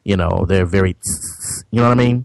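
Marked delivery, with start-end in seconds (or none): 0.9-1.6 soft, drawn-out "tss"; stretched-out S hiss. Shown as a demonstration. Panelist showing how some stretch the S, soft sibilant hiss. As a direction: hiss the S sound twice, without voice. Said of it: "either with the lisp or they stretch out the S".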